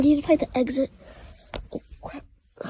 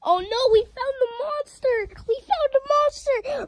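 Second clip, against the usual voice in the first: mock alarm; 10 semitones higher than usual.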